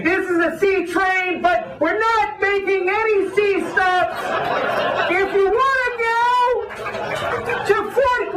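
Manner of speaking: high, slow, blaring conductor call; mock conductor, high and drawn-out; train conductor announcement. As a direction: shout it out like a subway announcer over a crackly speaker. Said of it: "The guy's like".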